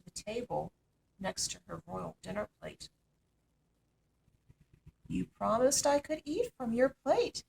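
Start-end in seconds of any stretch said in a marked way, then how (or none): 5.1-7.4 higher insistent frog voice; frog reminding. A narrator's reading. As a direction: press the princess about her promise. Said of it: "said the frog".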